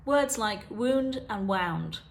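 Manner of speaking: wound said first as the injury, then wound as the past of wind, slowly; two pronunciations. Shown as a demonstration. Teacher demonstrating a heteronym to viewers, slow careful contrast. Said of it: "I'll give you an example of heteronyms".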